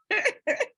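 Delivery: high-pitched burst of laughter. Real, not acted. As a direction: laugh up high, short and bright. Laughs at her own joke.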